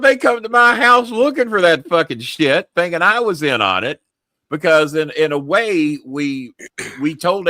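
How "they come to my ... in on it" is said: loud, high indignant outburst; angry retold rant. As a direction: blast it out in disbelief.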